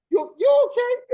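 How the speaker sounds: high, loud, slowed worried question; alarmed check-in. Retold or imitated. Umpire asking the batter if he's okay, high and alarmed. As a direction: rush to see if he's hurt.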